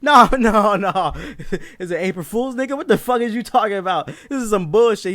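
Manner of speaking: high, loud indignant protest; hammed-up outrage breaking into laughter; mad. Customer disputing the cashier's total, mad. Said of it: "I was mad as shit".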